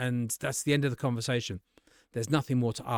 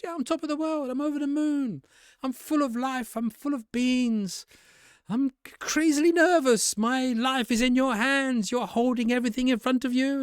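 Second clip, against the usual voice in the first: much higher, beaming, full of energy; over-the-top enthusiasm; 11 semitones higher, 4 dB louder than usual. Shown as a demonstration. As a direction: play it bursting with joy. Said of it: "So you'll be on top of the world".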